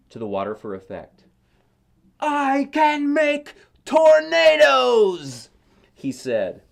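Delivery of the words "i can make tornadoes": very high, very loud, very slow boast; proud boast. A narrator's reading. Boy showing off his blender tornado, loud slow proud boast. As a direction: crow it out like a little storm god.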